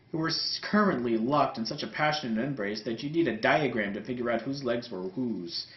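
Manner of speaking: usual speaking voice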